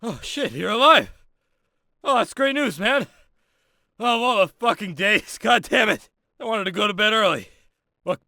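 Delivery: high-pitched, rattled relief and griping; agitated exclaiming. Acted. Instructor finds recruit alive during attack, agitated exclaiming.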